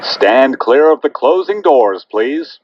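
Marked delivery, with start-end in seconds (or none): none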